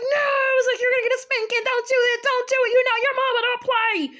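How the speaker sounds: high, mock-panicked pleading; hammed-up wail of warning; retold frantic warning.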